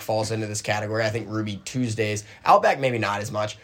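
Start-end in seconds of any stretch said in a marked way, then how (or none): none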